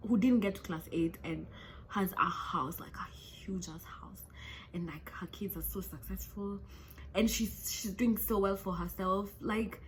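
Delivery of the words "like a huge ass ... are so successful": soft unvoiced whisper; whispered aside. Real, not acted. Telling viewers about a rich dropout, whispered gossip.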